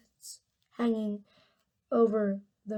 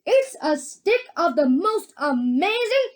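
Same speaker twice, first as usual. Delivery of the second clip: loud, high, showman's boast; excited proclamation; 7 semitones higher, 8 dB louder, slower than usual. A narrator's reading. Wonka boasts about his amazing gum, loud excited proclamation. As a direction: build it up and stretch the ending grandly. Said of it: "cried Mister Wonka".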